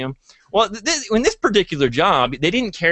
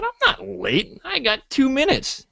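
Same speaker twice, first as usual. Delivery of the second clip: defiant reply; 11 semitones higher, slower than usual. Retold or imitated. Arguing with boss in retold quarrel.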